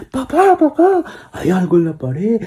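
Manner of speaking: very high frightened calling of "papá, papá". Acted. Girls calling dad about the wall, frightened high cry. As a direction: cry out like a scared little girl.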